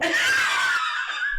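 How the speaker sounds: high squealing burst of laughter; shrieking laugh. Real, not acted. Laughing mid-imitation.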